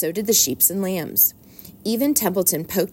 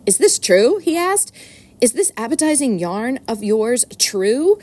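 higher, louder excited rat voice; eager rat; 5 semitones higher, 4 dB louder than usual. Acted. Templeton hearing of the fair's food, eager and loud. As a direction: jump at it, eyes blazing. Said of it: "Templeton's eyes were blazing".

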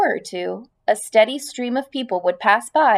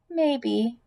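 soft, faint spider voice; quiet; 2 semitones higher, 6 dB quieter than usual. Acted. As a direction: barely say it. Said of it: "said Charlotte quietly".

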